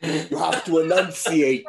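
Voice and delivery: loud, high, slow over-enunciation; mock enunciation. Co-host joins the elocution joke, over-enunciated. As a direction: drag out ENUNCIATE like an elocution coach.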